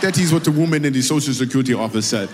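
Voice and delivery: mimicking the guest's Zimbabwean accent; mock Zimbabwean accent, carefully parroted; Zimbabwean accent impression. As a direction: echo her in a put-on Zimbabwean accent. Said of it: "I do that accent really well".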